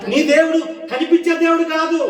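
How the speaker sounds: high, loud declaration; full-force preaching. Real, not acted. Preacher telling the congregation God is unseen, high loud shout. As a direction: shout the point to the whole room. Point shouted, then repeated quietly.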